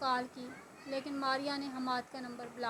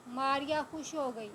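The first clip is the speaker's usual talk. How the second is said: usual speaking voice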